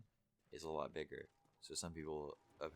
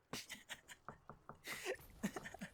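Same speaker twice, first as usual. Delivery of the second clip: very high, voiceless bursts of laughter; real laughing fit. Real, not acted. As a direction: crack up at your own impression. Cracks up at own imitation.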